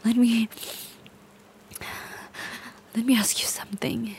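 fast, breathy whisper; menacing whisper. Acted. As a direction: lean in and whisper the threat.

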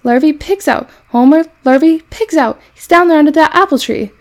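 high, loud call across the farmyard; urgent holler that the pig is loose; alarmed calling out. A narrator's reading. Alarm called out across farm.

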